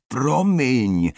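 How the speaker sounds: high, loud cry of apology; panicked yelp. A narrator's reading. Panicked Oskar apologises again to screaming Malin, crying out. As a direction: cry out in mounting panic, drawn out.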